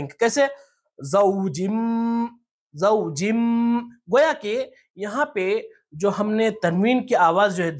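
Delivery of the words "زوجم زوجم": very slow زوجم with a long hummed final m; tanween read as meem. Shown as a demonstration. Teacher showing how tanween changes, زوجم with held meem. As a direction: stretch the closing m of زوجم through the nose. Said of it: "میم سے بدل کر پڑھیں".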